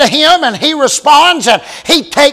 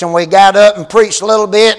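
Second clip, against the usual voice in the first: normal talking voice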